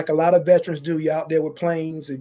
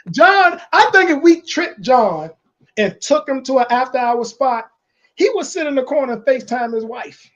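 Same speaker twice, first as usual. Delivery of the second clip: excited; 5 semitones higher, 4 dB louder than usual. Real, not acted. Excited teasing about John.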